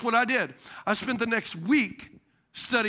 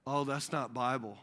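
dismissive repairman; 10 semitones lower, 10 dB quieter than usual. Retold or imitated. Repairman condemns the church.

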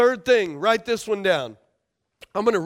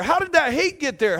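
ordinary talk, nothing marked

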